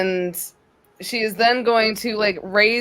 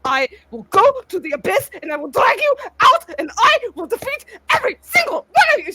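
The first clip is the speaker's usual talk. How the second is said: higher, loud, fast furious shout; raging vow; 9 semitones higher, 3 dB louder, faster than usual. Acted. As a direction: rattle it off in a fury. Grendel vows to defeat them.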